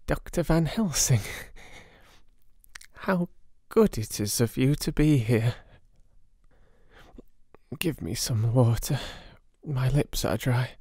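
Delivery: high, breathless, slow broken voice of a feverish madman; weak dying patient. Acted. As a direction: barely push the words out, pained and confused. Dying patient speaks weakly.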